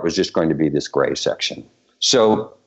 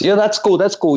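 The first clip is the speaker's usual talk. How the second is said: quick, high-pitched eager agreement; retold excitement; 11 semitones higher than usual. Choreographer retelling the star's reply to his ideas, excited.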